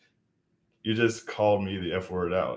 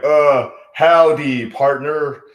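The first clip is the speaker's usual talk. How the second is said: slow, loud hammed-up cowboy American drawl; fake American accent; 4 semitones higher, 6 dB louder, much slower than usual. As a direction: stretch out howdy partner like a mock Texan. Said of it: "nail the American accent".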